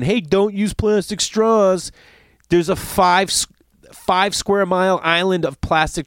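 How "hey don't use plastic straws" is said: slow, higher, lightly mocking hippie plea. Mocking hippies who lecture about straws, slow and high. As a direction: drawl it like a preachy hippie. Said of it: "hippies out there saying".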